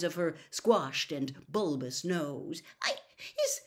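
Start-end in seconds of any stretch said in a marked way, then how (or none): 2.8-3.6 stammering, then loud, very high squealing; squeaky little elf in tearful panic; Winky. A narrator's reading. Sobbing house-elf protests innocence to the wizard, stammered squeal. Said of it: "squealed Winky".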